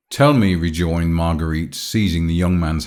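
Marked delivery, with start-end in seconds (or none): 0.1-0.5 higher-pitched, mildly pleading; supplicating. A narrator's reading. Marguerite seizes the young man's hands, supplicating. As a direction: plead to be told. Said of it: "with a supplicating air".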